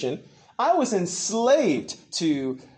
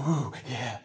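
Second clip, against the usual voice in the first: low, soft mock swooning; entranced follower; 8 semitones lower, 9 dB quieter than usual. Preacher imitating people falling for the show, entranced. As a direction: murmur it as if swept away, in parody.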